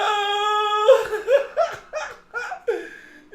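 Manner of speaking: high, loud, helpless laughter; shrieking laughter. Real, not acted. After yelling a name, high shrieking laughter. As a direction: laugh until crying.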